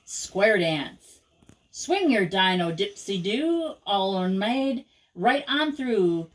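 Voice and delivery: ordinary delivery, nothing marked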